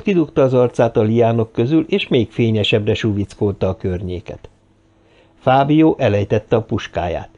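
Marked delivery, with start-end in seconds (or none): none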